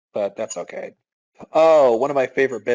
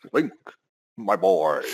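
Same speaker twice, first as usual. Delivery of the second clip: CD-i king voice; 3 semitones lower than usual. Retold or imitated. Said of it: "king from the Zelda C D I games".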